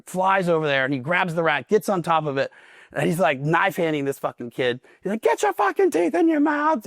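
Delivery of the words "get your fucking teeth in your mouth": very high-pitched strained order; high mock bellow; yelling drill instructor. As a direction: imitate an instructor screaming in a recruit's face. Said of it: "he's yelling at him".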